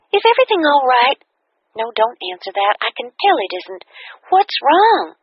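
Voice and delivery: high, quick worried questions; concerned friend. A narrator's reading. Kathy senses something is wrong, worried and high.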